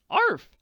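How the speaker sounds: very high, loud yap; a happy little dog bark. Acted. Sandy barks arriving at the farm.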